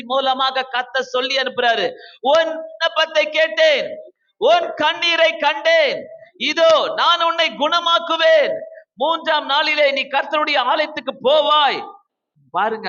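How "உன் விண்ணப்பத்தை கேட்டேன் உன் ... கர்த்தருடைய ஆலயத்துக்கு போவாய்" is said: preacher voicing God's promise; fervent proclamation. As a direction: proclaim it with mounting force.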